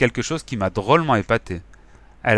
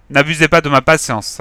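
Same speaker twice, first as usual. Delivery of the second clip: loud, higher threat; threatening; 4 semitones higher, 8 dB louder than usual. Acted. Gang leader threatening the inspector, loud. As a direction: warn him menacingly. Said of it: "a menacé le bigleux".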